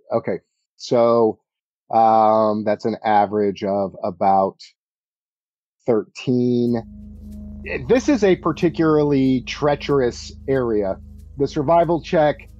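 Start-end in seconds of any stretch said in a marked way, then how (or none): none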